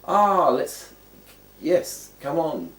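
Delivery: high, loud, drawn-out urging; acted encouragement. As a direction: coax someone on with big open swoops. Voicing a beckoning, encouraging gesture.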